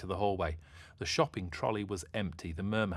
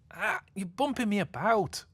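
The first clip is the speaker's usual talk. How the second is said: complaining; 14 semitones higher, 6 dB louder than usual. Acted. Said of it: "complained the masked mermaid".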